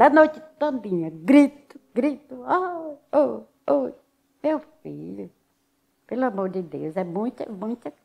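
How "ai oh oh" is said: high mock wail of a suffering patient; imitating moans of pain. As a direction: cry out the way the sick moaned at night. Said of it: "E outros gritando". (gemido imitado, agudo)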